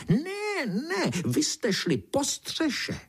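very high, drawn-out shriek of denial; screeching beetle. A narrator's reading. Beetle contradicting the narrator, screeching. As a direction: squeal it slowly as a cross beetle. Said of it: "černý brouk vřískal dál".

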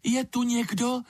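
very high-pitched storybook call; calling out with all her might. A narrator's reading. Lizard calls into the silent rock, high calling voice. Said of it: "zvolala ze všech sil".

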